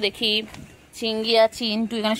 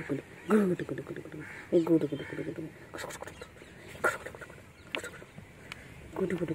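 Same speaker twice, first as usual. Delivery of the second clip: soft cooing; 3 semitones lower, 11 dB quieter than usual. Real, not acted. Cooing nonsense to pet.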